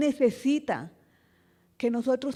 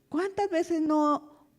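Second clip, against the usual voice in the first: usual speaking voice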